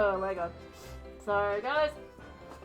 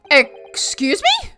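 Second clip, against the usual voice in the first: incredulous; 7 semitones higher, 6 dB louder, much slower than usual. Acted. Judge startled by thrown bottle.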